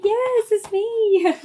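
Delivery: slow, high, loud near-tearful reassurance; overjoyed. Retold or imitated. Daughter telling her mother yes it's me, slow, high and loud. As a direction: stretch it out, thrilled and moved. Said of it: "it almost made me cry".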